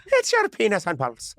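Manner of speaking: put-on playful stab at an Irish accent; mock Irish accent. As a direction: echo it in a hammy Irish accent. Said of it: "trying to do an Irish accent".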